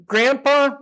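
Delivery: imagined little grandson. Retold or imitated. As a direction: call to grandpa with a hint of a small child. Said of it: "if you can imagine his voice".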